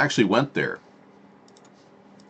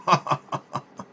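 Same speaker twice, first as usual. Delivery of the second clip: villain laugh; 6 semitones higher, 3 dB quieter than usual. Pretend. Laugh after the mock Russian line.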